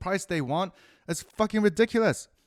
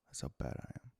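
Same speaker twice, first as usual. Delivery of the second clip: lower whisper; 16 dB quieter, faster than usual. Real, not acted. Confessing to viewers again in a deeper whisper. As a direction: repeat it as a lower, softer whisper. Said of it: "repeated whisper".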